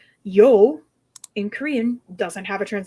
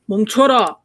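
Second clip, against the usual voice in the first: louder "멈춰라" as an order to halt; period-drama command; 6 dB louder, much faster than usual. Shown as a demonstration. Showing a word from Korean period dramas, commanding. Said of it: "antiquated word meaning stop or halt".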